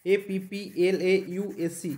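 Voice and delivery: very slow, letter by letter; spelling out. Shown as a demonstration. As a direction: spell it out slowly for a class. Spelling the word out for students. (ধীরে ধীরে বানান করে বলা)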